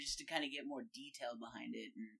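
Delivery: ordinary talk, nothing marked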